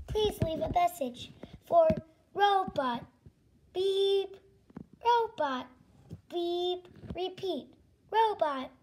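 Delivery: monotone answering-machine robot; evenly spaced robot words. Acted. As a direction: recite it like a recorded machine message. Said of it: "I am a robot".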